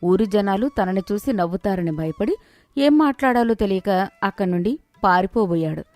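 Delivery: plain reading voice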